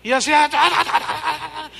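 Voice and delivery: high, rapid ta-ta like a jackhammer; mock jackhammer tongues. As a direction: imitate her drilling babble. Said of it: "parecía un taladro".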